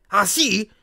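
bare your teeth at it; snarling. A narrator's reading. Harry hearing they talked about him, snarling. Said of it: "ringhiò Harry".

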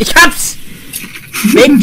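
loud, high triumphant yell; triumphant shout. Real, not acted. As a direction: shout as you finally catch it. Catching bug on his hand.

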